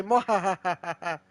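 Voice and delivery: drawn-out villain laugh, slow; slow hammed-up cackle; slow evil mwahaha. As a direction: stretch the mwahaha like a cartoon villain. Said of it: "You say".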